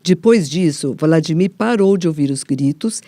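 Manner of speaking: ordinary talk, nothing marked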